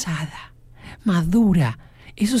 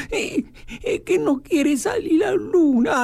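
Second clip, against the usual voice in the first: high, whimpering little-girl voice through mock tears; crying little girl; 10 semitones higher than usual. Host imitating the sad little girl, mock crying. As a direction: play a small girl sobbing that the moon won't come out.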